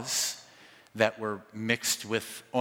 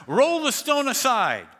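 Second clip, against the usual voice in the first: angry command; 13 semitones higher, 7 dB louder than usual. Acted. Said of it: "He's angry".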